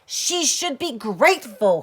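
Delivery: very loud, high bellow; mother bellowing at her daughter. A narrator's reading. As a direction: roar it at the child. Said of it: "her mother bellowed".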